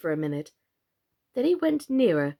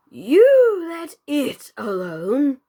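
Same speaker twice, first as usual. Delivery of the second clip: louder, very slow frog; panting frog; 5 semitones higher, 8 dB louder, much slower than usual. A narrator's reading. Old frog pants advice to Alice as he hobbles off. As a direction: puff the words out breathlessly. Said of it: "he panted out".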